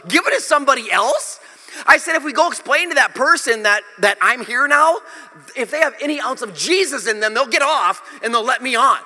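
high, indignant outburst; worked-up retelling of an outraged passenger; upset protest. Retold or imitated. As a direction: argue heatedly, voice pitched well up. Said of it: "I was so upset".

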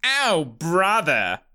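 slow mocking drawl from the crowd; sneering heckler. A narrator's reading. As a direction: jeer it at the newcomer.

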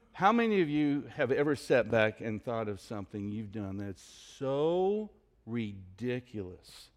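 normal talking voice

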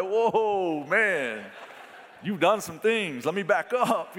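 preacher recoiling at the man's answer, half laughing; startled backpedal. Retold or imitated. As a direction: play the shocked preacher backing off. Retelling his startled reaction.

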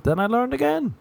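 a silly high comic squeak; sudden high squeak. Acted. Comic mocks his own learning, high squeak. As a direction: jump way up on the line. Comic self-deprecating bit.

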